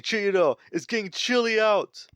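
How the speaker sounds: high-pitched dad calling to his son. Retold or imitated. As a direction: call out to your son outside, voice lifted high. Father calls son out on balcony.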